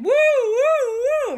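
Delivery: high, very loud swooping woo; whooshing sound. Acted. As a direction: let out a big rising-and-falling whoosh. Magic strength whoosh sound effect.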